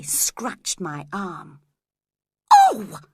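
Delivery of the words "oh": sudden very high, loud cry of pain; scream. A narrator's reading. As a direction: yelp as if scratched. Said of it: "I screamed".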